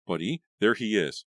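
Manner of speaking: loud urgent cry. A narrator's reading. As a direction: point and cry out. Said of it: "Melissa cried".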